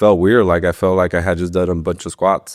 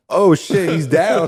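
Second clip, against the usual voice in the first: high, laughing burst of surprise; excited disbelief; 10 semitones higher, slower than usual. Retold or imitated. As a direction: blurt it out like you can't believe he dropped. Retelling opponent dropping.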